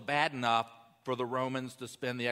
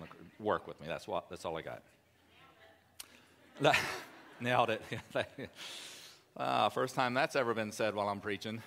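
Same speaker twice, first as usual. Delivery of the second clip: ordinary delivery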